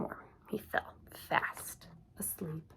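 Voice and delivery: whispered, fading, very soft; slow whisper trailing to silence; drifting to sleep. A narrator's reading. Hedgehog falls asleep, story ends.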